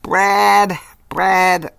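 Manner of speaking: loud, high repeated call of the name. Acted. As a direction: yell his name across the room. Calling Brad across the room.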